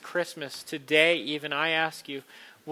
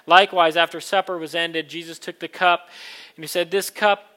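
liturgical reading; 8 dB louder than usual. Acted. Words of institution.

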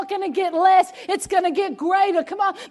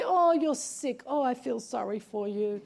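mock pity; 7 semitones lower, 7 dB quieter than usual. Preacher mocking a pity party, soft mock sympathy. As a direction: mimic a pitying voice, much softer. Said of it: "your little pity party".

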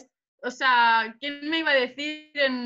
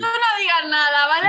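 loud playful warning, don't jinx it; loud teasing order not to jinx the fight; half-joking warning; 4 semitones higher, 7 dB louder than usual. Real, not acted. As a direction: shout it out to stop her jinxing it.

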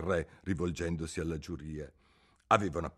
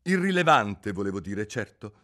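quick king; 7 dB louder than usual. A narrator's reading. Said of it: "disse il re prontamente".

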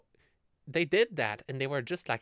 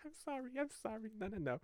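much softer, high sheepish sorry; quiet giggly apology; soft apologetic; 10 semitones higher, 12 dB quieter, faster than usual. Real, not acted. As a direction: apologize under the breath. Apologizing for dark joke.

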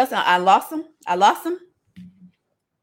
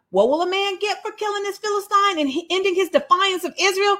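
eager questioning; 8 semitones higher, 4 dB quieter than usual. Acted. Acts David asking the soldiers.

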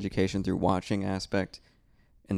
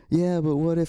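whiny doubt; 8 semitones higher, 4 dB louder than usual. Retold or imitated. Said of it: "And I was like".

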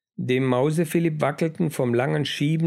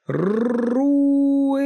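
very high, piercing roar with rolled R; durchdringendes Brüllen; 13 semitones higher, 4 dB louder than usual. A narrator's reading. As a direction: roll the R hard and let the final E ring out long. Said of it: "brüllte er mit durchdringender Stimme". (schrill durchdringend gebrüllt, langes E am Ende)

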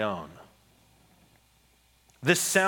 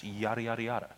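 dismissive yada yada; 8 dB quieter, faster than usual. Shown as a demonstration. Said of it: "just breeze through it".

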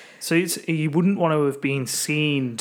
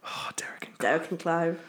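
whisper; 3 semitones higher, 5 dB quieter than usual. Real, not acted. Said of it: "reverent whisper".

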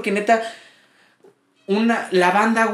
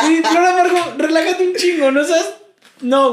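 worked-up plea; 8 semitones higher, 3 dB louder, slower than usual. Real, not acted. Addressing the singer, worked up.

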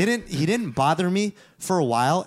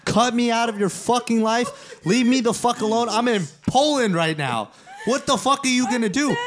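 re-enacted angry tirade, pitched up; spazzing out; 6 semitones higher than usual. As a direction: go off on him, voice raised high. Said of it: "I spaz on him".

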